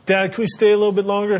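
put-on high, fast pleading kid voice; begging kid. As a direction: rush the plea like a kid.

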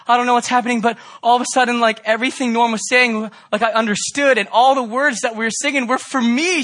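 high, loud, breathless rush of joy; girl in tears of joy telling the pastor; excited girl. Retold or imitated. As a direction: gush like a girl overwhelmed with joy. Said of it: "She's like".

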